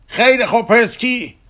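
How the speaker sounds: high, loud call to someone far away; shouting. Acted. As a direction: holler it urgently. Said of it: "داد زد".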